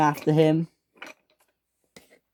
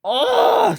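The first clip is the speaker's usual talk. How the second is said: very high, loud "ugh"; high, mad screech of a groan; angry scream; 5 dB louder than usual. Acted. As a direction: shriek with frustration. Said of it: "screamed James, who was very mad".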